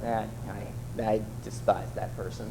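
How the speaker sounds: low, quiet private sneer; muttered inner grudge. Retold or imitated. Churchgoer muttering his grudge under his breath. Inner thought, passive-aggressive.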